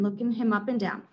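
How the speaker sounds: usual speaking voice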